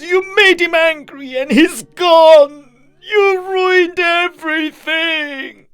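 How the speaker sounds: very high, loud, desperate cry; tearful wailing. A narrator's reading. Drunk begs vanished guest, blames neighbors, wailing. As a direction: wail after him, then drag out the curses. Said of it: "Mister Kim bursted into tears".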